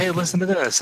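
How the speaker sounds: eager pestering. Retold or imitated. Partner pushing music before bedtime, eager pestering.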